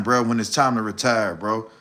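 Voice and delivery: ordinary delivery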